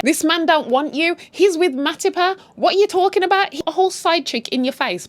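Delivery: exasperated. Real, not acted. Exasperated at the character.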